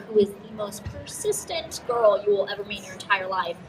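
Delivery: ordinary delivery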